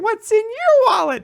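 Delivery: put-on very high toddler voice; squeaky small-child impression; Charlie. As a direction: imitate a little kid repeating his line. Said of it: "two or three more takes of him going".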